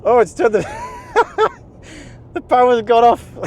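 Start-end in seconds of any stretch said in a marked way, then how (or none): 0.7-1.5 surprised high laugh. Real, not acted. Car loses power. 2.3-3.3 surprised. Real, not acted. Car loses power.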